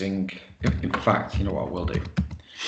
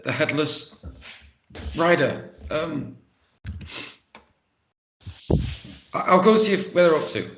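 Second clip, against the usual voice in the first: lightly raised, overly cheery voice; performing for others; 7 semitones higher than usual. Acted. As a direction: play it up as a bit of a show. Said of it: "put on a bit of a performance".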